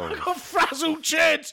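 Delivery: slow, high shout; silly shout. Acted. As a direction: shout it out slowly.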